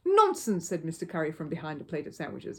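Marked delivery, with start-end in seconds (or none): 0.1-0.7 high loud brusque retort; gruff dismissal. A narrator's reading. Mr Curry, wanting to see the trick, retorts high and loud. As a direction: wave the objection away.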